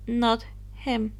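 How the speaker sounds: ordinary delivery, nothing marked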